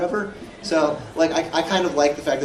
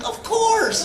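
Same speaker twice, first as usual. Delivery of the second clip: high squeaky mock confidence; hammed-up eager-to-please actor; overeager actor's yes. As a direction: agree to the absurd, voice shooting way up. Actor's eager answer to absurd request.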